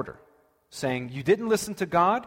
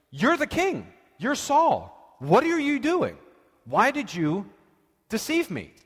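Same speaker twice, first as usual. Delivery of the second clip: a much higher, alarmed voice; panicked medium; 7 semitones higher, slower than usual. Retold or imitated. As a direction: voice the medium panicking as she realizes who he is. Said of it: "she's freaking out about this".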